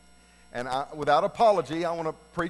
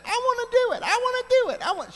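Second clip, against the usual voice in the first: put-on high, pleading little-girl whine; whiny five-year-old; 4 dB louder than usual. Little sister begging to work the combination, whiny high voice. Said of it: "my sister kept saying".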